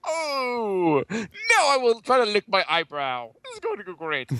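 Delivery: high, put-on performer voice; hammed-up announcement of a silly trick; mock showman. Performer mocking the corny requests, high showy voice.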